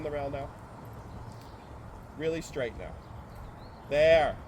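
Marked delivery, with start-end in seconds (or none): 3.9-4.4 sharp, loud "there"; loud praise. Real, not acted. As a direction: snap out the approval. Praising a good moment.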